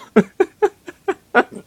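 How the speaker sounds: high, wheezy giggle; uncontrolled high laughter; helpless laugh. Real, not acted. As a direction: crack up in high little bursts. Cracks up at story line.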